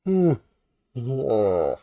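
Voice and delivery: a loud, open-mouthed yawn; big yawn. Real, not acted. Tired reviewer yawning mid-recap to viewers, real yawn. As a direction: yawn it out, tired from staying up all night. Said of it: "Sorry about the yawning".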